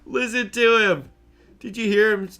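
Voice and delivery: excited about the oinking pig; delighted exclaim. Real, not acted. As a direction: share the joy loudly with viewers.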